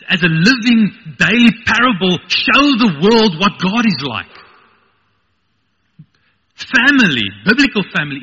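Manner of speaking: loud, high, emphatic sermon delivery; full-force preaching. Real, not acted. Preacher urging that family reflects God, full-force preaching. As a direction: preach it out with rising fervor.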